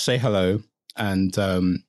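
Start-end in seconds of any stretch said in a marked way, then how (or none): none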